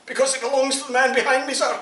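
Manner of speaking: fast, high, loud through laughter; laughing punchline. Acted. Soldier gives the punchline to the sergeant, laughing fast. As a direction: rush the punchline, cracking up.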